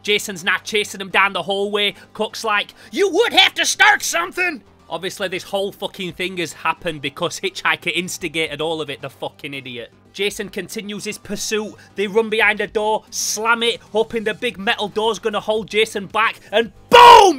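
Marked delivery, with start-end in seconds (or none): 2.9-4.6 high, loud scolding Southern character voice; angry Cook. Acted. Cook scolds Hitchhiker for starting trouble, high exasperated shout. 16.9-17.4 big shouted impact noise, high and drawn out; explosive boom. Acted. Jason punches straight through the door, explosive shouted boom.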